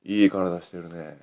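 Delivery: slow, hushed leering stranger; slow creepy come-on. Retold or imitated. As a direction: drawl it low and suggestive. Retold bathhouse stranger's line. (ゆっくり囁くような口説き声)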